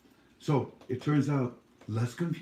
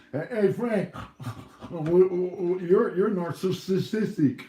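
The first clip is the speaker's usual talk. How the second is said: mocking heckler; 6 semitones higher, 4 dB louder, much slower than usual. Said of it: "he goes".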